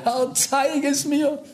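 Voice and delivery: preacher crying to God for a topic, high and slow; retold desperate cry. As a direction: pitch it up, begging and drawn out. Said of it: "Ich hab immer mehr geschrien".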